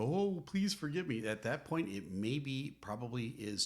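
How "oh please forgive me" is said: high, hammed-up begging; a spouse begging as divorce papers arrive, mock desperate; mock desperate plea. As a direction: plead like it's too late. Begging too late at divorce.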